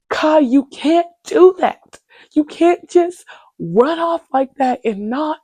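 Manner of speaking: high, loud, slow worried friend voice; imitating worried Nina. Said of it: "Nina's like".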